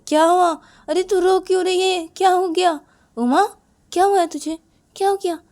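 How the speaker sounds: high, loud, startled concern; alarmed questioning. Acted. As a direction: urgently ask a crying girl what's wrong. Girl asks crying Uma what's wrong.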